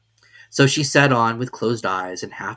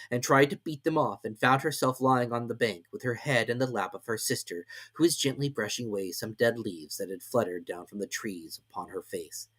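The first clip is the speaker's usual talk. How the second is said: ordinary delivery, nothing marked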